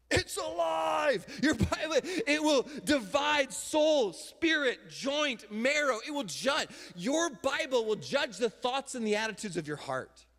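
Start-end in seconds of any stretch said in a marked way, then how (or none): 0.1-1.3 slow, high, shouted proclamation. Acted. Preacher to congregation, proclaiming the living Bible, slow shout. 1.4-8.5 loud, high, slow pulpit proclamation; fiery preaching. Acted. Preacher telling congregation what the Bible does, loud and high.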